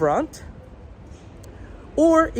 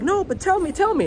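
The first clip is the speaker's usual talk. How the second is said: put-on higher, eager pleading girl voice; mock girl begging; 10 semitones higher than usual. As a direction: lightly play the curious girl.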